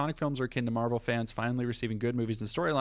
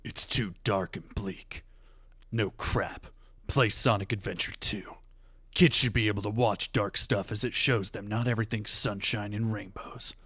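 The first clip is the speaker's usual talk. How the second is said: Shadow voice; 3 semitones lower than usual. Shown as a demonstration. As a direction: brood it out like Shadow. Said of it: "I gotta do a Shadow voice".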